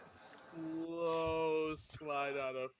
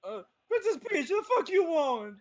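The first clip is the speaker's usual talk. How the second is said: loud, high cartoon Italian plumber; Mario voice; 13 semitones higher, 14 dB louder than usual. Plumber yelling at Princess Peach, hammed-up Italian voice. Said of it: "Mario Brothers".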